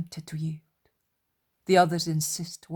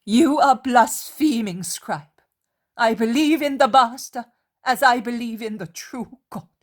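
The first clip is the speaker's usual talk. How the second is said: indignant rebuke; 6 semitones higher, 8 dB louder than usual. A narrator's reading. Martha rebukes blasphemy.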